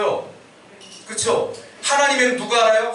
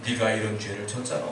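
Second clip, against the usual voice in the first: much lower, quieter voice of God; hushed low divine rebuke, acted; God's reproof, imitated; 10 semitones lower, 7 dB quieter than usual. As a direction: answer as God, low and restrained. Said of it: "하나님께서".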